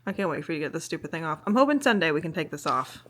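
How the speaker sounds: normal talking voice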